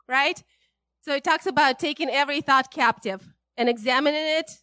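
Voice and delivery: ordinary delivery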